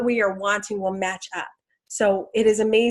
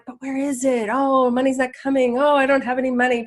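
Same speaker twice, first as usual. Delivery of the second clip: higher, lightly acted grumble; mock complaining; 4 semitones higher, 3 dB louder than usual. Speaker mimicking her own doubts about money, mock complaint. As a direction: imitate yourself moaning about being broke.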